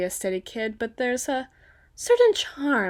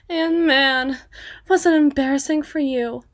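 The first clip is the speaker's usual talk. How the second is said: louder, higher jeering; mocking taunt; 4 semitones higher, 6 dB louder than usual. Woman taunting a gang she beat, mocking and loud. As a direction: rub in their embarrassment loudly.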